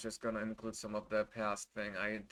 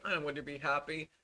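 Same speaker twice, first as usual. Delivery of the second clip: ordinary talk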